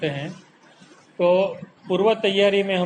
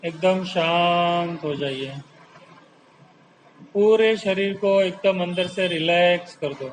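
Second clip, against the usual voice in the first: slow, drawn-out calming delivery; unhurried yoga-teacher relaxation voice; guided relaxation; slower than usual. Real, not acted. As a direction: stretch the key words to settle them into calm.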